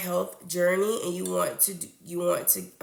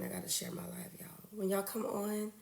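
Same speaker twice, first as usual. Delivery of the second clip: hushed, not too loud; 10 dB quieter than usual. Real, not acted. Streamer on her live, whispering to the viewers. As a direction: keep it hushed so nobody's disturbed. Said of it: "I'm trying not to, um, talk too loud".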